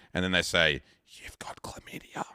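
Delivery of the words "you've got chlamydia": hushed whisper of the news; whispered diagnosis. Retold or imitated. Doctor breaking the news, in a whisper. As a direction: breathe it out like a secret.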